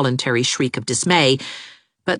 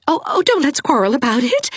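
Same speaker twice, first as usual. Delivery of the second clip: high pleading lady; a tearful mother pleading for peace; high, tearful plea; begging; 12 semitones higher than usual. A narrator's reading. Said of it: "begged Missus Raymond".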